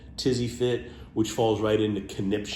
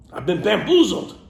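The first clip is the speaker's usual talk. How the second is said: playful mock dupe; 10 semitones higher, 8 dB louder than usual. Showing off a fun word, hammed-up. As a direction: ham it up like a fooled cartoon.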